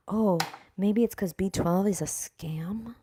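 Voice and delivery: slow whispered aside, half sneer; slow conspiratorial whisper; sarcastic whisper. Acted. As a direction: breathe it out like a sly secret. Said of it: "sarcastic whispered aside".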